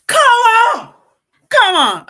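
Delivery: punchy loud exclamation; loud come on. Real, not acted. Vlogger hyping up her viewers, loud and punchy. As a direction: hype the crowd up. Hyped closing rally.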